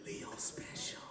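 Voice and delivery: hushed, breathless whisper between teachers. Retold or imitated. Teachers whispering about Leo behind his back, hushed. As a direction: whisper it like gossip. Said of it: "they was whispering it to each other".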